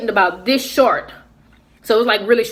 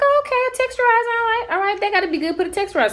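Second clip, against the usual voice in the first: much higher, fast and eager; naive excitement; 12 semitones higher, faster than usual. Retold or imitated. Narrator mimicking her younger self eagerly agreeing with the clerk. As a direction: gush like you just found the answer.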